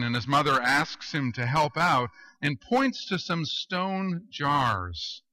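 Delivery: ordinary talk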